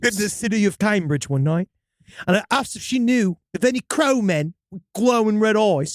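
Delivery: storyteller in a put-on British accent; hammy English-accented first-person tale. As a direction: read it with an amateur British accent. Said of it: "Central Florida version of Sherlock Holmes".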